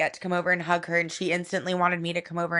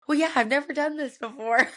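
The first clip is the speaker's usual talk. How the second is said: mom's laughing reply; 7 semitones higher than usual. Retold or imitated. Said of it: "She's like".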